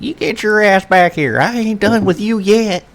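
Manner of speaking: put-on loud, high American country accent. The character yells after a fleeing cat in a country accent. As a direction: holler it in a thick country accent. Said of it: "Pull out the ca-, country accent".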